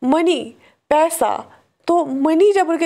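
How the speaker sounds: ordinary delivery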